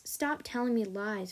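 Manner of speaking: usual speaking voice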